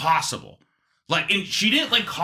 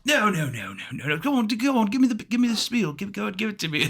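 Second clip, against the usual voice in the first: put-on higher, insistent British old man; Britishy accent; 7 semitones higher than usual. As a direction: urge them on as a British caller. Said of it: "Britishy accent".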